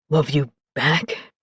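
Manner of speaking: low, slow, wondering question; deep male voice asking. Acted. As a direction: ask it low, hardly believing.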